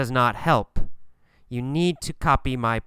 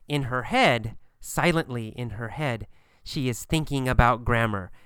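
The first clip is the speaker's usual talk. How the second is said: ordinary delivery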